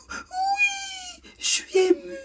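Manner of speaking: put-on very high wailing child's sob; imitating a crying child. Mother imitating her son sobbing that he is moved. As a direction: cry it out like a little boy overwhelmed. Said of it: "Il pleurait".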